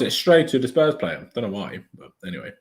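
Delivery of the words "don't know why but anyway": ordinary talk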